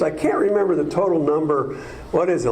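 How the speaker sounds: ordinary talk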